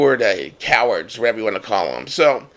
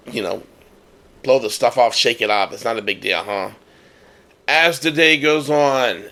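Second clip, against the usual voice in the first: usual speaking voice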